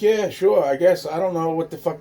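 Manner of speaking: put-on high, loud, unsure child's voice; naive kid reply. Boy answering the girl's question, high and unsure. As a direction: answer like a confused little boy.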